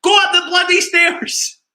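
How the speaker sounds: high, loud yell; dad yelling at his son on mute; furious shout. Retold or imitated. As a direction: roar it up the stairs. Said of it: "put myself on mute".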